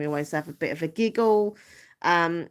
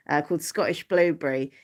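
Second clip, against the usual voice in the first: ordinary talk, nothing marked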